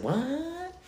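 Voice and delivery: drawn-out, high rising "what"; baffled what. Retold or imitated. Replying to an angry stranger on the phone, baffled. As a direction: let it climb high in quiet disbelief.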